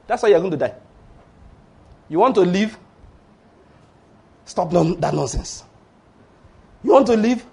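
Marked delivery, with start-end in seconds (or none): none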